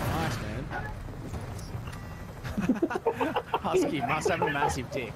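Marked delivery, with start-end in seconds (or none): 0.7-3.5 wheezing laugh. Real, not acted. Laughs at chat message.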